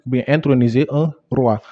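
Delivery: ordinary delivery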